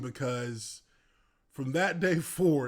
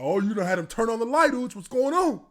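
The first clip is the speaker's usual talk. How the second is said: loud, fast mocking tease; teasing lineman; 7 semitones higher, 7 dB louder than usual. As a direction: rib him hard and fast. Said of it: "He said".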